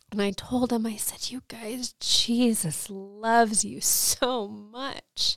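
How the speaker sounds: real tears, half whispered, very slow; overwhelmed and fighting tears; emotional. Real, not acted. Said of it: "I'm gonna get emotional now too".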